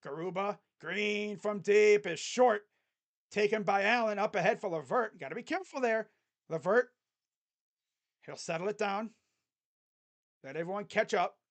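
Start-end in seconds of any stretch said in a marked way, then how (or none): none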